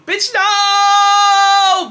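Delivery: high, loud, stretched-out no; hammed-up howl of dread; panicked freak-out. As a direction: wail it like punishment is coming. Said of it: "I started tripping".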